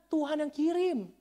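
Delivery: high, loud preacher's cry; emphatic shout. Real, not acted. Preacher telling congregation who sent the money, emphatic shout. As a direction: shout it high as a triumphant declaration. Repeated take at full force.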